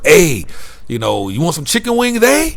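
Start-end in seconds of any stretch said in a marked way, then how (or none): 0.0-0.4 "a." said as a high, loud Canadian eh; mock Canadian eh tag. Vlogger imitating Canadians ending with eh, high Canadian eh. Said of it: "ends with the word". 1.3-2.5 high, sing-song imitation of a Canadian accent; mock Canadian accent. Vlogger imitating a Canadian waiter offering wings, Canadian accent. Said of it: "I love their accent out here".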